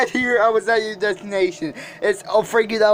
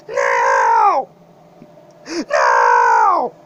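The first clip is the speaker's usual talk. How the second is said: low shout. Acted. Screamed final order.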